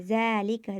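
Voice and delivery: ज़ालिका with the ज़ा held for one alif's length; recited with stretched vowel. Shown as a demonstration. Teacher reciting the example ज़ालिका, first vowel stretched. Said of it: "ज़्यादा नहीं खींचेंगे".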